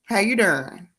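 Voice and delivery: down-home Southern hello; put-on folksy check-in on the family; countrified greeting with pauses between questions; Badia voice. Shown as a demonstration. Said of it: "I do use my Badia voice".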